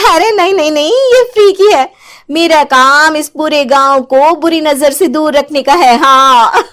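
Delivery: sweet-talking her out of suspicion; sly witch in disguise. Acted. Witch insists doll is free. (ऊँची, मीठी बनावटी आवाज़ में चुड़ैल)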